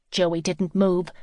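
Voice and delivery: ordinary delivery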